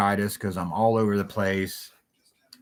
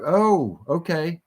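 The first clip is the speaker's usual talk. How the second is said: higher, drawn-out oh of sudden understanding; dawning realization; 8 semitones higher, much slower than usual. Retold or imitated. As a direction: let it click, mock relief. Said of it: "I'm like".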